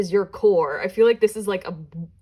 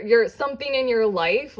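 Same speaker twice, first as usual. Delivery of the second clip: ordinary talk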